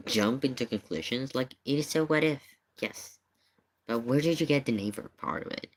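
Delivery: ordinary delivery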